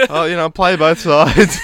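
slow, high, loud mocking sing-song; mock fence-sitter. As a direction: tease the ones playing both sides.

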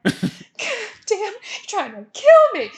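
loud, high mock accusation; pissed-off grandpa. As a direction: cry out as an outraged old man. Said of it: "and he was so pissed".

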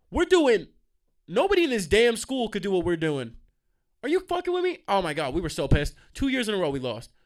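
loud, high-pitched outraged rant; heated. Real, not acted. Breakdancer still sore about losing twice, heated outburst. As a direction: protest the injustice at the top of your range. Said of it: "I'm getting heated".

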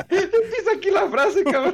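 laughing hard while teasing his friend; high-pitched laughter through the words. Real, not acted. Co-host laughing as he teases his friend, laughing.

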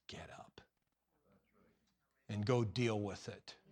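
hushed, low command; soft no-nonsense dismissal; quiet firm order. Acted. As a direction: say it low and matter-of-fact, slowing down. God answering Gideon's self-pity.

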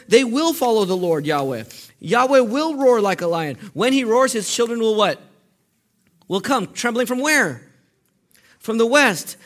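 high, loud scripture proclamation; full-force preaching. Acted. Preacher calls out the prophecy for the congregation to finish, fervent. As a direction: belt out the promise, punching each phrase.

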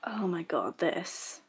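hushed disbelief; whispering. Real, not acted. Disbelieving aside.

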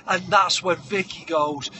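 ordinary delivery

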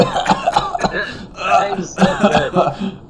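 laughing. Real, not acted. Laughing while choking.

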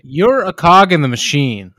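loud, forceful mock dressing-down; hammed-up bossy tirade; mock berating. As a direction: play at putting someone in their place, loudly. Mock boss putting someone down.

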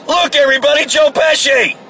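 high, excited mock announcement; calling out. Man imagines outing the star to the bar, calling out. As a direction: holler it to a whole room.

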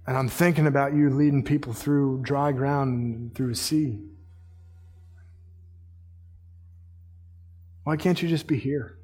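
low, subdued, halting plea; quiet prayer. Acted. Sleepless psalmist asking God to be here, quietly pleading. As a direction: speak softly to God with long pauses.

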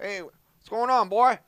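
loud, high, drawn-out Texan holler; Texan uncle. Retold or imitated. Nephew imitates his Texan uncle greeting him, loud drawl. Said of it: "That's how he talks".

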